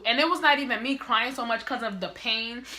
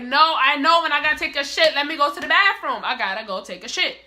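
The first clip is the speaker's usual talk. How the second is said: louder, higher retold pleading; raised-voice insistence; insistent protest; 5 semitones higher, 7 dB louder than usual. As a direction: argue back urgently, acting it out. Retold argument with nurses.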